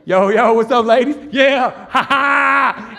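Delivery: loud, swaggering mock greeting; overdone hard-man holler with a forced laugh; fake tough bravado. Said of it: "try to appear a little bit harder".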